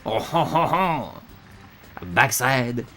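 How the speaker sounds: hammed-up French accent with a ho-ho chuckle; mock-French list; bad French accent. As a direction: put on a cartoon French accent, word by word. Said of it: "I apologize for my bad French accent".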